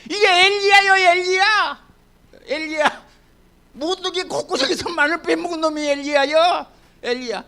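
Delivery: high, loud exclamation, fading; excited reveal. Acted. Pastor to congregation, high excited reveal of the name. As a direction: announce the name with a burst.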